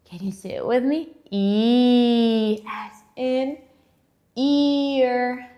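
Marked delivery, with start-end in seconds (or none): 1.3-2.6 E as a long, held "eee"; sustained long vowel "ee" for E; long E sound. Shown as a demonstration. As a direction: draw E out as "ee", as in ear. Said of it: "it's a long sound".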